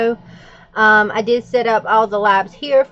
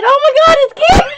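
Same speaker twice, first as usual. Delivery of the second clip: high, loud thrilled exclamation; excited squeal; 6 dB louder, faster than usual. Retold or imitated. Calling husband on the phone, loud high excited squeal. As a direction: shriek it out like a big discovery. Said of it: "I said".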